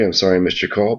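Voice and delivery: ordinary delivery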